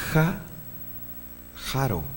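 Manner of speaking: slow syllable-by-syllable "ja, jaro", soft guttural j; sounding out jaro. Shown as a demonstration. Teacher sounds out the Hebrew word for the class, slowly. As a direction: spell out "jaro" one piece at a time. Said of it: "Aquí dice".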